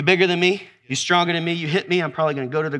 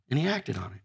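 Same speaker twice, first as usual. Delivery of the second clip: ordinary talk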